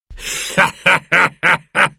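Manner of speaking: high, hearty mocking laugh; Silver's laugh. A narrator's reading. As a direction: laugh at the fleeing cowards. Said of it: "laughed Silver".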